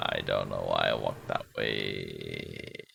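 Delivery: very low, slow, soft character voice; deep put-on voice.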